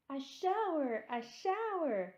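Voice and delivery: put-on high, loud children squealing; excited scream. A narrator's reading. Children cheering the hose-spray shower, screaming with glee. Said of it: "screamed Missus Ralph's four children".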